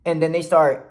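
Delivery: ordinary talk, nothing marked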